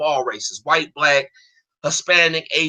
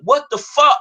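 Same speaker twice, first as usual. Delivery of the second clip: retold outraged outburst, pitched high; steamed-up reaction; 8 semitones higher, 4 dB louder, slower than usual. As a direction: relive your indignant disbelief. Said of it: "I was so steamed up about it".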